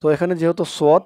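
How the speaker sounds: ordinary talk, nothing marked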